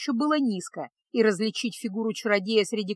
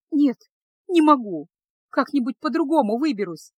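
usual speaking voice

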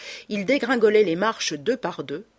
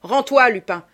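raised, forceful shout; yelled order; 5 semitones higher, 8 dB louder than usual. A narrator's reading. Ganimard, revolver out, orders Lupin to surrender, yelled order. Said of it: "hurla Ganimard".

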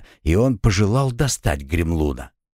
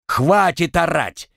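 higher, slower exasperated cry; раздражённый крик; 7 semitones higher, slower than usual. A narrator's reading. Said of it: "не выдержав, закричал Артём". (сердитый окрик, не выдержав)

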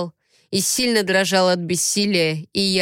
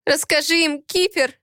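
high, frightened begging; trembling plea; 8 semitones higher than usual. A narrator's reading. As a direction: beg with a tremble in the voice. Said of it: "с дрожью в голосе взмолилась она". (мольба с дрожью в голосе)

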